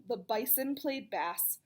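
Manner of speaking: ordinary delivery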